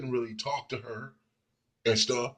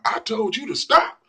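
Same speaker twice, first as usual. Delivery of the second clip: higher, louder scolding shout; retold yell; 11 semitones higher, 8 dB louder than usual. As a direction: yell it at his back. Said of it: "yelling and cussing at him".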